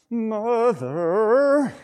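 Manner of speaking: high, drawn-out whining "mother"; exasperated son. Acted. Jesus answering Mary's request for help, mock exasperation. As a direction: groan it out like a put-upon son. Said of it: "you could take it this way".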